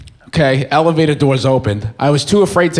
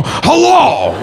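much higher, shouted hello; Pacino hello; 8 semitones higher than usual. Retold or imitated. Retelling a terrifying film moment, shouted hello. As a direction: shout it like the scary movie. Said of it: "orange person go".

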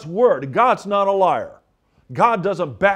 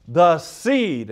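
seed leaned on and drawn out; stress on seed. Shown as a demonstration. Preacher stressing seed to his listeners. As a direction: hit the word seed hard. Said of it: "and I want to emphasize seed".

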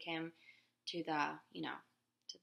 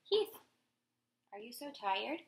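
usual speaking voice

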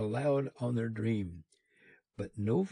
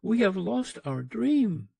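higher and louder, a mild anguished cry; crying out in grief; 9 semitones higher, 6 dB louder than usual. A narrator's reading. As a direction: let the loss break out in a lifted voice. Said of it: "they cried".

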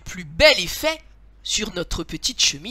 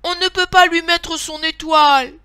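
high, loud indignant protest; scandalised little bear's shout; outraged cry; 8 semitones higher, 10 dB louder than usual. A narrator's reading. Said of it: "petit ours criait au scandale".

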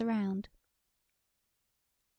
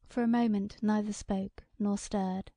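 ordinary delivery

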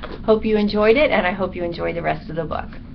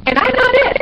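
very high, loud protest; squeaky, defensive little bat; indignant bat; 7 dB louder, faster than usual. A narrator's reading. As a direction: squeal the denial emphatically.

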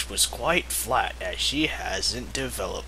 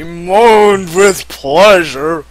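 slow, much louder moaning read; mock moan; 4 semitones higher, 14 dB louder, much slower than usual. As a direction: draw it out like a moan. Reading sex scene, moaning.

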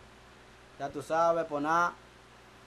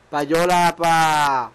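very slow, much louder call; drawn-out announcement; 9 dB louder, much slower than usual. Acted. Shouting payola to the camera, drawn out. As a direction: stretch the word like a hype man.

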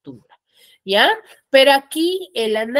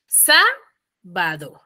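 very slow "Sábado", long SA, then lower "bado"; stress on SA; 4 semitones lower, much slower than usual. Shown as a demonstration. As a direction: hold the first syllable of "Sábado", pause, finish "bado". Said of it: "Es en la sa". («Sábado» muy lento, cargando la SA)

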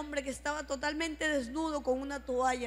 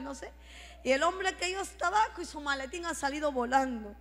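ordinary delivery

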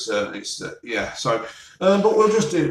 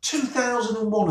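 higher, softer incredulous echo; disbelieving repeat; 10 semitones higher, 4 dB quieter than usual. Real, not acted. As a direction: repeat the year in mock disbelief. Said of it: "and they're putting that on Christmas Day".

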